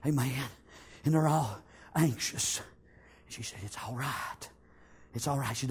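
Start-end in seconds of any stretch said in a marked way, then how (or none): 3.6-5.6 soothing. Retold or imitated. Rahab calming her family.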